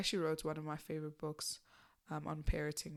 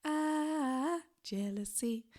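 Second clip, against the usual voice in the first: dramatic; 9 semitones higher, 5 dB louder, much slower than usual. Acted. Host announces the topic dramatically.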